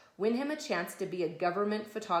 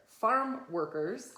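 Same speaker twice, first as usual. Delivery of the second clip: put-on drawn out for the child; slow, clear repeat; much slower than usual. Shown as a demonstration. Mother repeating a word slowly for her son. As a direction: say farm workers slowly. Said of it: "What's a farm worker?".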